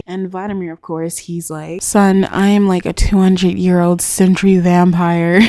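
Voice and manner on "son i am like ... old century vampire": loud, emphatic put-down in own voice; hammed-up, forceful comeback; mock vampire retort. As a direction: play an old vampire loudly setting someone straight. Acting old vampire's retort to question.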